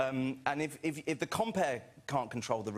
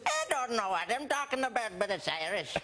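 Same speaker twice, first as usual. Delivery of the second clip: higher, vague, put-on Irish accent; 7 semitones higher than usual. Shown as a demonstration. Comic admits he can't do Irish, vague Irish accent. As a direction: fake your way through an Irish accent. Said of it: "and then I can do that one".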